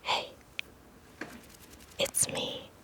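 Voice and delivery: ordinary delivery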